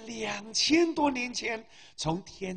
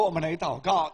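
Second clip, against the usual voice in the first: ordinary talk, nothing marked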